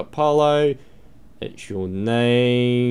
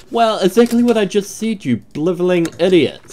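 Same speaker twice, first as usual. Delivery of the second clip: higher, irritated scolding; mock exasperated rant; 7 semitones higher, 4 dB louder than usual. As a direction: scold the fool in mock anger. Insulting an entrant in character.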